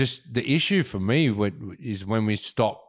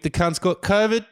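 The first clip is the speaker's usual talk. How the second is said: normal talking voice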